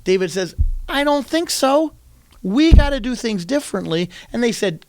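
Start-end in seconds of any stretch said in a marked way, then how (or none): 0.9-4.1 higher, louder defiance; defiant retort. Retold or imitated. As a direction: push back with bold resolve. David defies his brothers.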